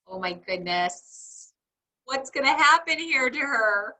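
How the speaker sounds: usual speaking voice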